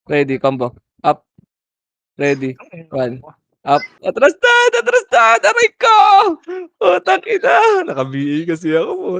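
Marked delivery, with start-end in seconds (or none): 4.0-7.9 loud, high yelling out in pain; pained shout. Real, not acted. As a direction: scream as you get hit. Hit in game, shouting. (malakas na sigaw sa sakit)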